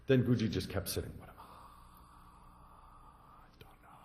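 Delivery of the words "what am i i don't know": unvoiced whisper to himself; whispered I don't know. Guji whispering his koan to himself in meditation. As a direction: breathe it like an inner koan.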